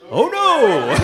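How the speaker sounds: high, loud mock dread; shrill terrified oh no; horrified. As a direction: squeal oh no in horror. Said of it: "I'm like".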